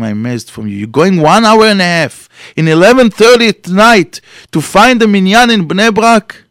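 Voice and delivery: rising to a high, loud incredulous question; stunned admiration, voice climbing; amazed disbelief. Acted. The driver amazed at the man's devotion, high and loud.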